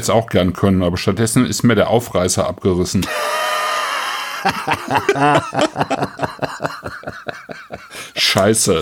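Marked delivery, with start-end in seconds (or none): 3.1-8.1 howling laugh breaking high. Real, not acted. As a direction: burst out laughing at the mishap. Tin opener pull-tab rips off.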